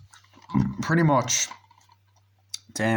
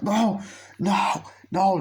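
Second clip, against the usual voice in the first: much higher, panicked no; mock scream; 9 semitones higher, slower than usual. Victim pulled into the car, much higher panicked cry. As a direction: protest as you're dragged off.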